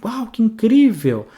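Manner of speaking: high, slow, wide-eyed wow; drawn-out delighted awe; amazed exclamation. Shown as a demonstration. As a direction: gush over something incredible. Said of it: "entonação maior".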